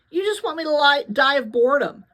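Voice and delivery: grumbling. A narrator's reading. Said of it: "Teo grumbled".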